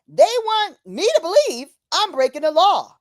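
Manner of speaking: usual speaking voice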